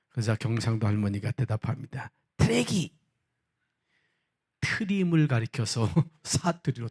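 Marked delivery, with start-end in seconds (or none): 2.4-3.0 Gyeongsang grandma. Acted. Gyeongsang grandma answers in game.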